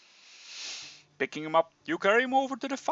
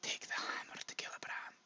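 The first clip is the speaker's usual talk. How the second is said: dying whisper, much softer; low whisper; 16 dB quieter than usual. Acted. Dying dwarf pleading with the hero, whispered. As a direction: breathe the words out, barely voiced. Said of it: "he speaks to you again in a low whisper".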